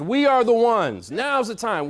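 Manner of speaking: loud, high, emphatic slogan; rallying cry. Shown as a demonstration. Speaker giving the crowd a slogan, forceful rallying cry. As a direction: punch it out like a campaign refrain.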